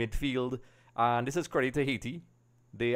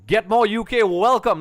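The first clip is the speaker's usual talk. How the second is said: loud, high-pitched welcome; excited shout-out; 9 semitones higher, 10 dB louder than usual. Real, not acted. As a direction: hail a loyal supporter at raised pitch and volume. Greets a longtime supporter arriving.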